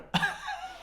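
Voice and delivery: sudden high-pitched burst of laughter. Real, not acted. Vlogger laughing at the Taoiseach segment, high burst of laughter. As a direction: let out a shrill disbelieving laugh.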